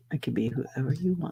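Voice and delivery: low, soft seductive purr; deep sultry voice. Shown as a demonstration. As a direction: breathe it low like a perfume ad. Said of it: "deep, sultry voice?".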